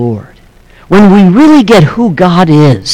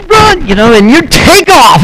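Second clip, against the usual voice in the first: mock panic; 9 semitones higher than usual. Shown as a demonstration. Acting out fleeing in fear.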